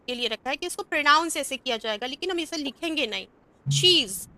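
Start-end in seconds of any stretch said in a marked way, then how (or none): 3.7-4.3 शीज said with a buzzing z at the end; she's with z. Shown as a demonstration. Teacher shows students the z sound in शीज. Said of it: "जेड लिखा है वो जा का sound दे रहा है".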